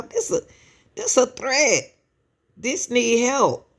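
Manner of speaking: emphatic alarm. Real, not acted. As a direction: drive home that this needs help. Insisting the case is urgent.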